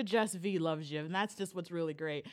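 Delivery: ordinary talk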